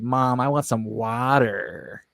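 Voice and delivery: drawn-out American "water"; slow American pronunciation, stretching "water"; American-accented daughter. Retold or imitated. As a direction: imitate a kid saying "water" with a hard American r. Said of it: "her daughter said".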